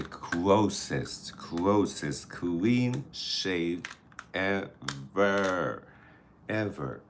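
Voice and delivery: slow, drawn-out "closest clean shave"; slow, selling it word by word; slow emphasis. Real, not acted. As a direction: stretch every word to sell it. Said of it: "drawn out for emphasis".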